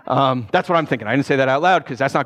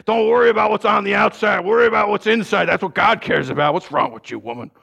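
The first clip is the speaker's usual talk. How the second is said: preachy lecture; 5 semitones higher, 3 dB louder than usual. Retold or imitated. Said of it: "Instead of me responding".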